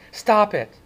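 high, loud cry of alarm; cried out. Acted. Cherry crying out to stop the knife fight, high and loud. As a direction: scream it to stop them. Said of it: "Cherry cried".

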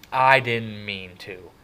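loud, slow, drawn-out angry echo; mocking shout. A narrator's reading. Brother throwing kid's excuse back, slow angry shout. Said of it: "Derry shouted".

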